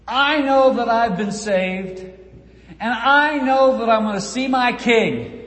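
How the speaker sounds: fervent declaration. Acted. Preacher declaiming a testimony.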